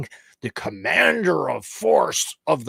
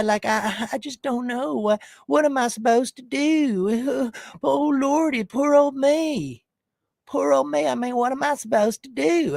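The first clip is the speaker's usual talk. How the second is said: whiny mock victim; 10 semitones higher than usual. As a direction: ham up a pitiful poor little guy. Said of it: "he's pretending like".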